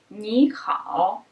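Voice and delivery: "Ni hao." with ni rising as second tone, hao dipping third; ni hao with sandhi. Shown as a demonstration. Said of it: "the first syllable changes into second tone".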